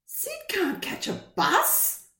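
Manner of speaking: put-on high, slow doubtful boy; child voice. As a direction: object like a small boy. Child Ben objects.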